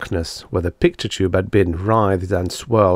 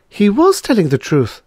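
high, louder shocked realisation on "was"; gasped realisation; 13 semitones higher, 5 dB louder than usual. A narrator's reading. Patient realises the Devi was honest, shocked. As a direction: hit "was" as the truth dawns. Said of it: "Miss Twilly gasped".

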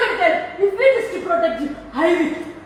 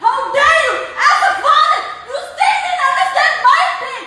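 higher and raised, crying; a daughter shouting her pain at her father; angry tearful shout; 5 semitones higher than usual. Real, not acted. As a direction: hurl it at him.

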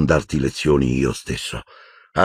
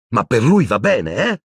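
higher, louder bitter shout; 6 semitones higher, 3 dB louder than usual. A narrator's reading. Harry shouts at Dumbledore about Snape, higher and louder. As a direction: hurl it at him. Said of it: "Urlò Harry".

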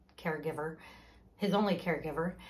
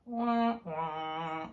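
sad-trombone "wah, wah", second one dropping lower; sing-song womp-womp for a killjoy's entrance; mimicked downer "wah, wah"; 3 semitones lower than usual. Speaker describing a gloomy person entering a room, mock deflated wah-wah.

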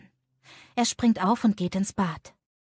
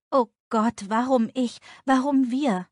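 rising, louder despairing outburst; anguished cry; 5 semitones higher, 5 dB louder than usual. A narrator's reading.

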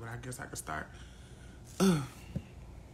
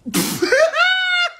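loud, high-pitched squealing laugh; 7 dB louder than usual. Real, not acted. Streamer alone after chatting about hometown, high laugh.

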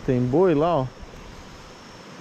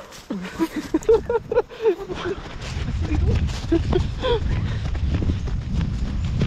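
panicked high laugh. Real, not acted. Running from the bull.

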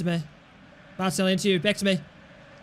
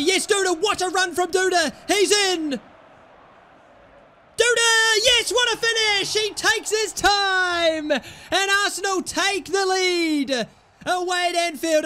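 goal celebration; 6 semitones higher than usual. Real, not acted. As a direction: roar it as the goal goes in. Scoring the winner at Anfield.

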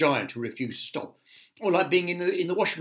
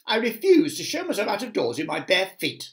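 higher, louder protest; indignant refusal; 7 semitones higher, 5 dB louder than usual. A narrator's reading. Centipede refusing to go out bootless, indignant. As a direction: object with fussy indignation.

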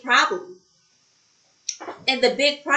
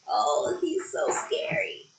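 hushed, higher mock-scared whisper; pretend fright; 9 semitones higher, 6 dB quieter than usual. Storyteller reacts to the troll, in a mock-frightened whisper. Narrator feigns fear of the troll.

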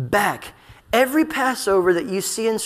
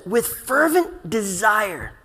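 slow, deliberate "fervent desire", each word drawn out; intense, drawn-out weight on "fervent desire"; intense fervor; much slower than usual. Retold or imitated. Preacher quoting Jesus at the Last Supper, intense. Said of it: "hear the intensity of his words".

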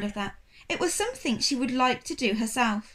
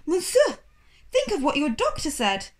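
alarmed protest; 5 semitones higher, 4 dB louder than usual. Acted. Assistant alarmed.

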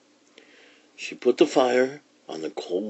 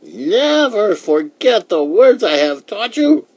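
put-on high, loud old lady warning; emphatic high-pitched old-woman caution; 12 semitones higher, 8 dB louder than usual. A narrator's reading. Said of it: "warned the old woman".

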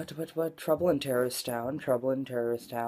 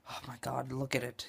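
ordinary talk, nothing marked

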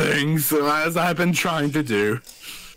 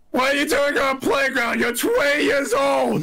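background yell; 12 semitones higher than usual. Acted. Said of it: "someone from the, uh, background yells".

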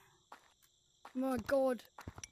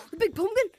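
excited shout; 9 semitones higher, 12 dB louder, faster than usual. Real, not acted. Excited discovery in game.

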